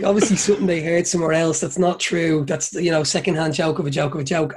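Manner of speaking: ordinary talk, nothing marked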